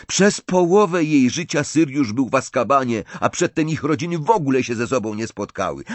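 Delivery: high, hurried, insistent argument; huffy protest. A narrator's reading. Ron defending his view of Tonks, bristling. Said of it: "zaperzył się Ron".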